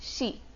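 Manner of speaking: "शी" opening on a hushing sh, softly; sh sound. Shown as a demonstration. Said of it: "श वाला sound". ("शी", धीमे, श की आवाज़ से)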